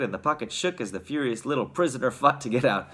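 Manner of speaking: ordinary delivery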